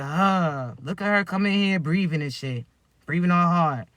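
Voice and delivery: low, soft sneering mutter of an annoyed partner; mocking grumble. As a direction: grumble it low, fed up with their breathing.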